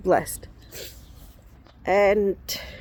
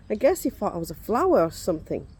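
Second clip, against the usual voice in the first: normal talking voice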